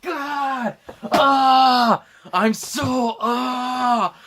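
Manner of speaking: high strained shouting cry; anguished cry. Acted. Friend nails the dying pilot's cry for the joke. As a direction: cry out like a man sacrificing himself. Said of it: "Exactly like that".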